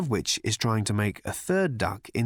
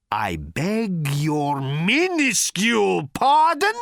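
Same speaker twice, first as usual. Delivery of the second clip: slow roar, climbing louder and higher; 8 semitones higher, 5 dB louder, slower than usual. A narrator's reading. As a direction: roar it slowly, building to the last word. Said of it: "roared Thor".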